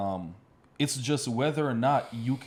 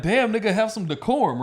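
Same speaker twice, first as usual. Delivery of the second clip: mock scold, much higher and louder; exasperated scolding; 9 semitones higher, 6 dB louder than usual. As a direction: call out the album cover in disbelief. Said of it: "it's like".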